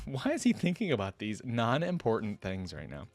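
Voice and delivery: plain reading voice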